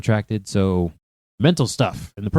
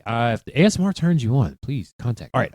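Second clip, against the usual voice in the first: ordinary talk, nothing marked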